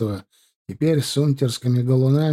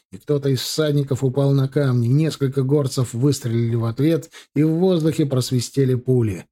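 ordinary delivery, nothing marked

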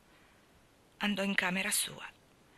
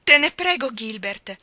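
higher and much louder, a begging outcry; pleading cry; 5 semitones higher, 10 dB louder than usual. A narrator's reading. Anna begs Gilbert not to propose, pleading loud cry. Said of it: "gridò Anna con occhi imploranti".

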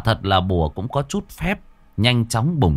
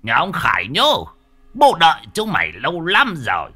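much higher, louder blustering challenge; bravado; 9 semitones higher, 4 dB louder than usual. Acted. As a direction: puff up and bluster at the ghosts. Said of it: "lão vẫn ra oai".

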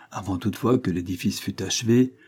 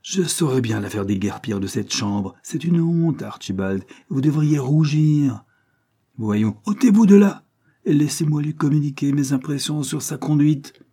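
furious scolding; 6 semitones higher, 4 dB louder than usual. Acted. Cornélie furious at her brother.

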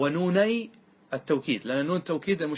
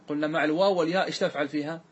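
ordinary talk, nothing marked

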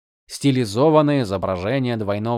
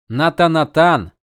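louder, slower shocked repeat; stunned exclamation; 5 semitones higher, 6 dB louder, slower than usual. Acted. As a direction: blurt it out in disbelief. Dalinar exclaims. (потрясённо, громко воскликнув)

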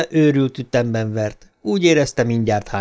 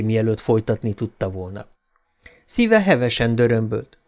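plain reading voice

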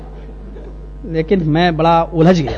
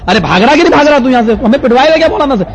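fast, higher-pitched scolding; imitated urgent chasing-off; 5 semitones higher, 3 dB louder, faster than usual. Mosque washer sending him away, fast scolding. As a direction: rush it out in exasperation.

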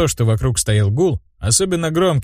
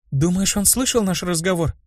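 higher, mildly worried question; anxious; 8 semitones higher, 4 dB quieter than usual. A narrator's reading. Anriel asking whether they were overheard, mildly anxious. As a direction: ask with a touch of worry. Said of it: "тревожный голос Анриэль".

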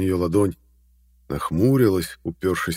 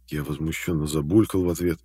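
ordinary delivery

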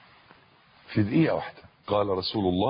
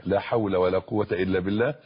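ordinary delivery

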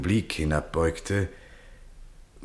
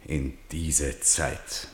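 slow, soft and scornful; slow contemptuous murmur; 5 dB quieter, much slower than usual. Acted. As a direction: drag the words out with quiet contempt. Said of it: "dachte der General verächtlich".

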